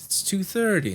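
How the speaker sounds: high-pitched mock exasperation; exasperated retort. Nurse correcting a patient about the time, exasperated.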